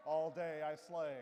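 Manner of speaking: loud, proud diva brag; sassy [name] boast. Retold or imitated. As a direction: belt it out like a diva. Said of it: "these are [name] quotes".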